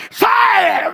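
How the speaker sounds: high, loud exorcising shout; shouted command. Retold or imitated. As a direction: command the demon out. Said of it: "fui crescendo em grito". (grito de ordem)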